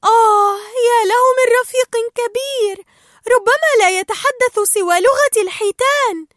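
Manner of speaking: very high, loud, gasping wonder; Dory amazed. Acted. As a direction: marvel at the huge whale. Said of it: "فقالت دوري". (دوري مندهشة بصوت عالٍ جدًا)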